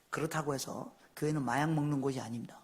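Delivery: normal talking voice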